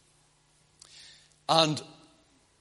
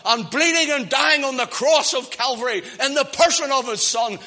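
raised, fervent sermon delivery; preaching; 5 semitones higher, 4 dB louder than usual. Real, not acted. Preacher picturing God's grace at Calvary, fervent preaching. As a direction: plead the gospel with building fervour.

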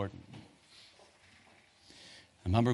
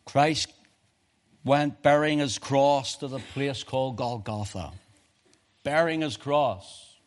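loud, high preaching cry; full-force preaching; 6 semitones higher, 9 dB louder than usual. Real, not acted. Preacher closing his sermon to the congregation, crying out loud and high.

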